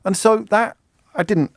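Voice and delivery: ordinary delivery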